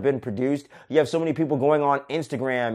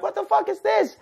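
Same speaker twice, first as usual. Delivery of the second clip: put-on squeaky, much higher kid voice; freaked-out kids. Kids shriek at creepy gifts in a squeaky high voice. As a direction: squeal it like a scared little kid.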